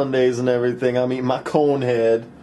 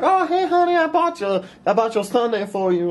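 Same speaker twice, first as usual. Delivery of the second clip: put-on much higher, gushing girly voice; high, sweet fan-girl voice calling after him; sugary girl voice; 11 semitones higher than usual. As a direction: gush at him like a clingy fan. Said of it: "She's like".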